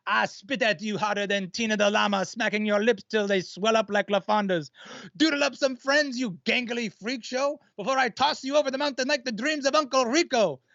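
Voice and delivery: put-on French accent rap verse; haughty French accent, rapped; french chef. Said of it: "I'll do the first verse".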